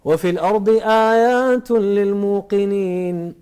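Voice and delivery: slow, drawn-out chanted recitation; Quran recitation. Acted. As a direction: stretch each word like tajweed recitation. Quran verse recited in sermon.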